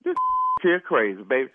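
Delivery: ordinary talk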